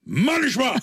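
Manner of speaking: a light take on the sketch character; Poli impression. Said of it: "אז פולי אומר לו". (חיקוי קומי וגבוה של פולי)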